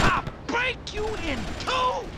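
high, hollered fighting boast; yelled threat. Acted. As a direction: threaten the enemy at a high yell. Battle threat shouted in a fight.

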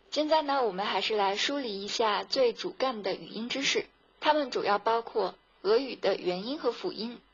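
ordinary talk, nothing marked

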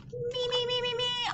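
put-on high, soft childlike me me me; high little chant of me; eager volunteering. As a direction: pretend to be an eager child begging to be picked.

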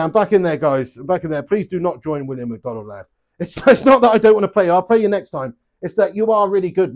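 normal talking voice

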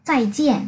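"Zài jian" said clearly as a model, jian falling; Chinese goodbye reply. Shown as a demonstration. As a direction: repeat "Zài jian" for learners to copy. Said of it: "đáp lại mình một câu y hệt". (đáp mẫu "Zài jian")

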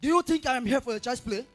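loud, high furious yell; furious shout. Acted. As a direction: shout her down with menace. Boy threatening girl with gun.

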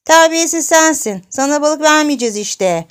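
put-on high, loud child's comeback; cheeky angry retort. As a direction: throw the insult straight back, loudly. Çocuk nineyle kavga ediyor. (çocukça, yüksek sesle karşılık vererek)

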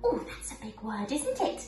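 gentle storybook narration; reading to children. Acted. Reads Sleeping Beauty to children.

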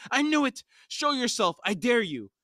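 very high-pitched frantic character scream; high, hammed-up scream of defiance; screamed challenge. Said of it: "Iskrul screamed".

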